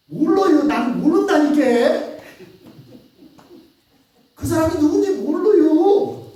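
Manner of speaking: loud, high and slowed; angry denial. Acted. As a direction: snap back and shut it down. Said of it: "그러니까 또 이야기하죠".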